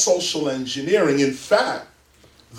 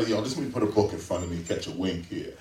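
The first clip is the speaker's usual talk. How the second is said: muttered aside; 12 semitones lower, 9 dB quieter, faster than usual. Retold or imitated. Mock inner voice, sneaking a nap.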